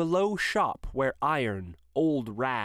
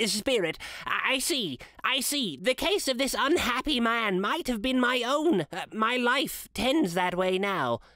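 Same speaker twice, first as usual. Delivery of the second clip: higher, shaken pleading; horrified Scrooge; 9 semitones higher than usual. A narrator's reading. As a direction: cry out to the spirit in dawning horror. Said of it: "listened to this dialogue in horror".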